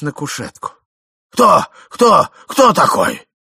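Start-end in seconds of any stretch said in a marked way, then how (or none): 1.3-3.3 loud, urgent, raised-pitch questioning; old man shouting. A narrator's reading. Old man questioning his wife, alarmed shouting. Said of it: "кричал старик".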